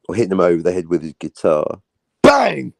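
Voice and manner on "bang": bang shouted loud; playful shouted impact noise. Pretend. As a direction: belt it out like a comic sound effect. Mimics guitar hit.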